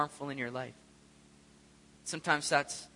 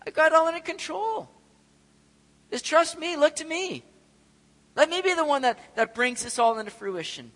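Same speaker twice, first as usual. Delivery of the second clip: higher, louder, gentle reassurance; reassuring God; 12 semitones higher, 7 dB louder than usual. Retold or imitated. God chuckling at his people's worry, higher and reassuring. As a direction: speak as God calming worried people. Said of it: "he just kinda chuckles going".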